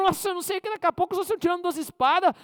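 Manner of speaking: high, loud hammed-up bragging threat; mock angry threat. Preacher acting the second quarreller, loudly threatening. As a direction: bluster like a quarrelling brawler. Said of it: "Um fala mais grosso que o outro".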